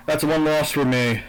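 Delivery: ordinary talk, nothing marked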